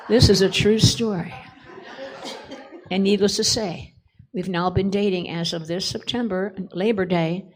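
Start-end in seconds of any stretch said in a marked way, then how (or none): none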